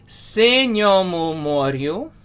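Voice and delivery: "Senyomu moryu" drawn out slowly, rising at the end; Ukrainian pronunciation. Shown as a demonstration. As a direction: model "moryu" with a rolled R. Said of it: "all R's are rolled".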